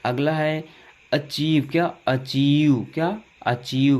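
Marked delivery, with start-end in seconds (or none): none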